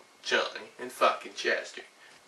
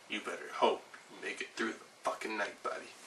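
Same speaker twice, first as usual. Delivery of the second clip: much softer menacing warning; quiet threat; 2 semitones lower, 10 dB quieter than usual. Acted. Threatening Gary after the yelling, softer and menacing.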